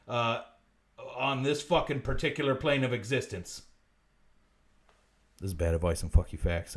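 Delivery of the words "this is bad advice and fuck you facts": much lower, deep announcer tagline; deep deadpan tagline; catchphrase. Acted. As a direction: read it like the show's title card. Show catchphrase repeated.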